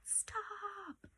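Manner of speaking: very high whispered stop; a sharp high breathy order; strong command. Shown as a demonstration. Teacher showing a strong feeling for the exclamation mark, whispered high. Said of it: "because I'm saying".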